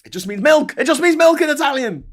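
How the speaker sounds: high, loud comic outburst; mock exasperated shout. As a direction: cry it out in hammed-up exasperation. Mock outburst at his latte mistake.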